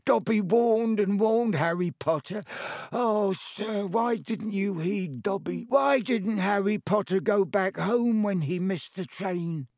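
high, squeaky elf voice, anxious and pleading; a fretful house elf begging Harry. Acted.

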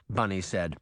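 ordinary delivery

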